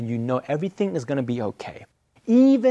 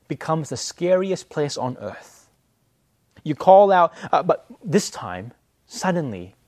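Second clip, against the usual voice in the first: ordinary talk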